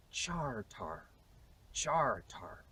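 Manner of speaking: "charter" as CHAR-TAR, both R's sounded; Shakespearean pronunciation. Shown as a demonstration. Historian showing Shakespeare's way, "charter" as char-tar. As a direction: draw out "charter" with a full AR in each syllable. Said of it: "Shakespeare would have said".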